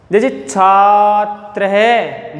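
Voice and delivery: छात्र है drawn out, visarga breathed as है; slow visarga reading. Shown as a demonstration. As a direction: model it slowly. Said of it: "तो अब उच्चारण करिए".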